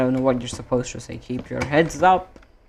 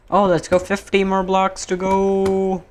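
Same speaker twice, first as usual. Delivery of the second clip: higher and slow, drawn-out celebration; excited cheer; 6 semitones higher than usual. Real, not acted. Streamer cheering his bridge progress to chat, delighted.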